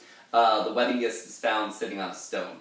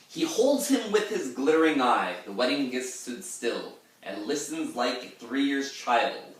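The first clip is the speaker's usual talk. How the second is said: poetic recitation; 4 semitones higher, 4 dB louder than usual. Shown as a demonstration. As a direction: recite the stanza with gravity. Poem stanza recited.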